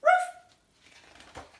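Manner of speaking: high, loud playful woof; friendly dog bark. Retold or imitated. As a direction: bark like a big happy dog. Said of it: "a big friendly bark".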